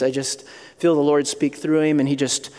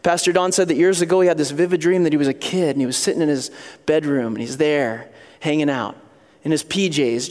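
normal talking voice

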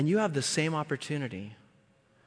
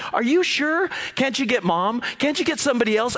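put-on high, louder anxious pleading child; scared kid pleading; 8 semitones higher, 4 dB louder than usual. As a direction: beg like a frightened five-year-old. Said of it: "I start negotiating with him".

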